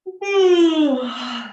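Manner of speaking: big yawn. Shown as a demonstration. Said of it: "a big yawn".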